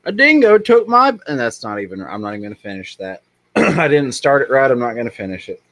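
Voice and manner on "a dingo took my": high, loud mock Australian accent; Australian accent pitched far up and loud; horrible Australian accent. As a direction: ham up a bad Australian accent. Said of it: "the horrible accents".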